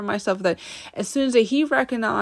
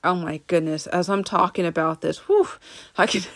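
ordinary talk, nothing marked

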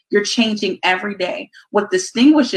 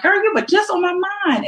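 excited friend; 12 semitones higher than usual. Retold or imitated. Friend voiced in a quote.

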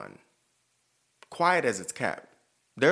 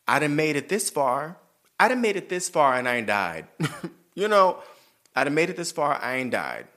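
loud triumphant; 4 semitones higher, 7 dB louder than usual. Real, not acted. Triumphant, louder declaration.

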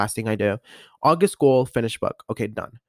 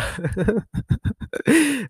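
high laugh; 10 semitones higher than usual. Real, not acted. Laughs after self-compliment.

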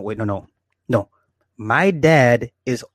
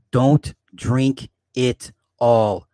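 slow warning; much slower than usual. Retold or imitated. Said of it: "I'll say".

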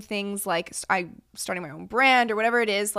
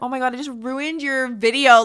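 loud, higher-pitched retold panic; freaking out; 4 semitones higher, 7 dB louder than usual. Friend recalling she spoiled the proposal video, freaking out. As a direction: act out a loud, dismayed realization. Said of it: "freaking out".

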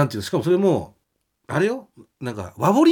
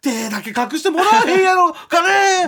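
high, loud Osaka-dialect pleading; apologetic Kansai plea, loud and high; Kansai manager voice; 12 semitones higher, 4 dB louder than usual. Retold or imitated. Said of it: "みたいな言ってくれて".

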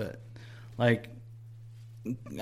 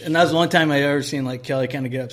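ordinary delivery

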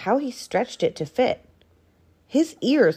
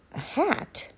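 soft, high, scornful question; fox's hushed disdain; sneer; 8 semitones higher, 7 dB quieter than usual. A narrator's reading. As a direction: sneer it quietly. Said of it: "sneered Fox".

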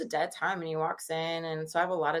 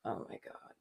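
whispered; 15 dB quieter than usual. Retold or imitated. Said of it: "he's like".

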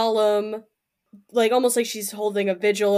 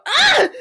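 sudden high, loud yelp of fright; short startled shriek; a character screaming in shock; 9 dB louder than usual. Acted. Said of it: "I scream".

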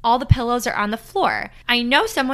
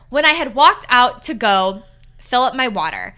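usual speaking voice

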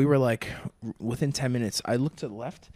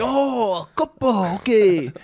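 high, drawn-out mock Japanese accent; imitated Japanese accent; 9 semitones higher, 6 dB louder, much slower than usual. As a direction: imitate a Japanese listener suddenly understanding. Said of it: "with a Japanese accent".

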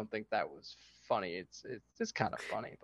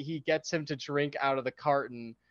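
ordinary delivery

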